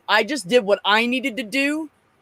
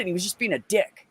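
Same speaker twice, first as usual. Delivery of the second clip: usual speaking voice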